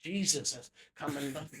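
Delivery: a hint of a church healer blessing someone. Preacher laying hands on a churchgoer, mock blessing. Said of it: "There'll be a guy that comes".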